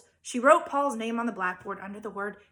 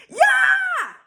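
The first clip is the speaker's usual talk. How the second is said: sudden shrieked yell, much higher and louder; one piercing high scream; child's scream; 12 dB louder than usual. Acted. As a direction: let it rip like a furious little girl. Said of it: "Leslie screamed".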